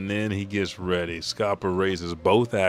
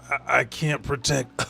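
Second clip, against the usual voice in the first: halting, higher pained voice, then a sigh; wheezing; 5 semitones higher than usual. Retold or imitated. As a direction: struggle the words out, then gasp. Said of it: "and he's wheezing".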